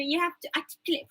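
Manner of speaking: normal talking voice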